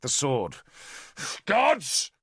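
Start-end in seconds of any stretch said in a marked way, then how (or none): 1.5-2.1 high, loud furious spit of a word; spat in rage. A narrator's reading. Arthur in sudden rage at the sword, spitting it out loud. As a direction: fling the word at the blade in rage. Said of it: "he spat at the quivering blade".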